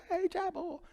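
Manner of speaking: high, soft chirpy imitation; bright high mock text voice; cheery Tammy. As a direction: sing it out like a happy assistant. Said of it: "'cause Tammy's always happy".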